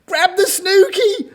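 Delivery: high, loud animal chorus shout; excited, squealed command. A narrator's reading. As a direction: yell it urgently to a drowning friend. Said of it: "shouted the giraffe and the tiger".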